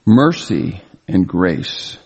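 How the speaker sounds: "Mercy and grace" spoken very slowly, each word weighted; solemn slow emphasis on both words. Shown as a demonstration. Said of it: "notice the emphasis on these words".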